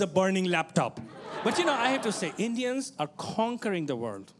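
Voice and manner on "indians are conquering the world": ordinary talk, nothing marked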